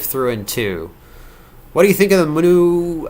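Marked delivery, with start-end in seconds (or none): 1.7-3.1 tired slurring late at night; sleepy, slurring the words together; slurred words. Real, not acted. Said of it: "I'm slurring my words now".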